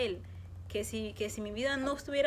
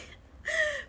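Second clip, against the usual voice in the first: a short embarrassed laugh, high; real laugh about the strange dream. Real, not acted. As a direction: laugh it off lightly. Laughs at her own story.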